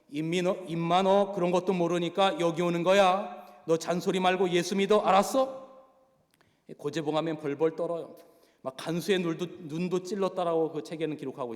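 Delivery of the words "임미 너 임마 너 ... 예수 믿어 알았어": louder and higher, a rough prison bully pushing faith; retold prisoner loudly ordering a new inmate to believe. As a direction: bark it at him like a tough jailbird. Go Jae-bong bullies the inmate.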